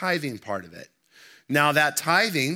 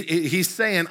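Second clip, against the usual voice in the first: ordinary talk, nothing marked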